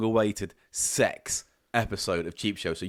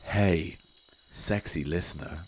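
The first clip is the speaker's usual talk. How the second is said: low, slow seductive greeting; sexy voice; 6 semitones lower, much slower than usual. Acted. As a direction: purr at the listener.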